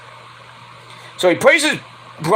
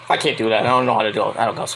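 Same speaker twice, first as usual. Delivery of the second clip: ordinary talk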